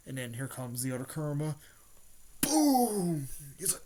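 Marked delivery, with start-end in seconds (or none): 2.4-3.3 loud, high explosion noise; shouted boom. Retold or imitated. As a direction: blast it out like a hit landing. Imitated impact in retold fight.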